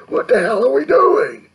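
very high, loud outburst; exasperated shout. Real, not acted. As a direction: yell it in exasperation at everyone. Exasperated climax of a rant.

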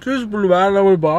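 words around food bits; talking while chewing. Real, not acted. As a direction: mouth partly full of food. Seen 1: Food visible in open mouth at 0.6–0.9s after cheese-pull bite.

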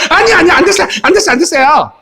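high-pitched, hammed-up protest; squealed comic pleading; mock student panic. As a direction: play students frantically begging off. Acted students refusing the test again.

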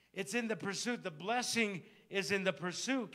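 loud, high, rising sermon delivery; full-force preaching. Acted. Pastor urging his congregation to respond to God, preaching loud. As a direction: preach it hard, calling out to the congregation.

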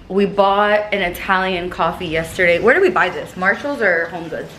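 ordinary talk

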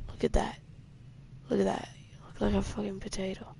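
hushed, fast mocking whisper. Real, not acted. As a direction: whisper the insult under your breath.

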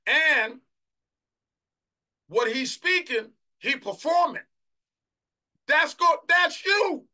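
high, very loud pulpit shout; preaching at full force. Real, not acted. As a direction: drive it home to the congregation in bursts.

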